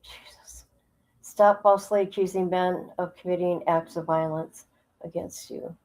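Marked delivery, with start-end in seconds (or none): 0.0-0.7 barely audible whisper; whispered dismay. Real, not acted. Reacting privately to the woman's video, whispered dismay. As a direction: breathe it out under your breath. Muttered reaction to played clip.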